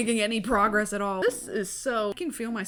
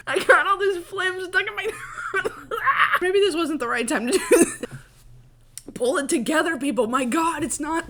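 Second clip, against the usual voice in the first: real laugh mixed with coughing, louder; laughing while coughing; 8 semitones higher, 6 dB louder than usual. Real, not acted. Vlogger laughing at her own coughing fit, louder. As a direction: laugh helplessly through phlegm.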